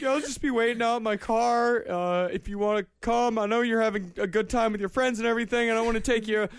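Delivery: whiny nice guy talking fast; pushy guy voice. Retold or imitated. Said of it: "He's like".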